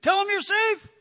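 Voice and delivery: high, loud frantic shout from the sidelines; shrill sideline yelling, pushing the boy to lie; retold frantic yelling. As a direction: yell it like a coach desperate for the call. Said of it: "he started yelling".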